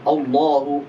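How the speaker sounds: Allahu with a thick rounded O, wrong way; wrong heavy o. Shown as a demonstration. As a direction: say Allahu darkened toward O. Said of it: "Bukan O".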